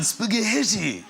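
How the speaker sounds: slow, stretched, very posh Spaghetti; posh Prince Charles. Retold or imitated. Mocking Prince Charles ordering pasta, slow posh drawl. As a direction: draw out Spaghetti like royalty. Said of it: "in a way that was so posh".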